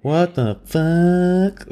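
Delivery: high, long-drawn disbelief; hammed-up, slow squeal of astonishment; mock shock. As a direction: stretch it out high, imitating stunned onlookers. Acts out others' shocked reaction.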